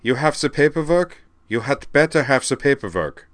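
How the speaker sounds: loud, high mock-German demand; pushy fake German officialdom; bad German accent. A narrator's reading. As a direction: demand it like a hammy German border guard. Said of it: "he adopted a bad German accent".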